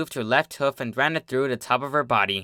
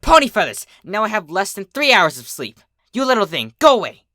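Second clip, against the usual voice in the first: much louder, much higher furious yell; furious outburst; 8 semitones higher, 7 dB louder than usual. Acted. Twilight yells at the mosquito that keeps her awake, furious. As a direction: blow up at the pest.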